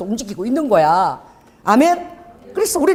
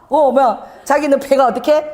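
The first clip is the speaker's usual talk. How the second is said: ordinary talk, nothing marked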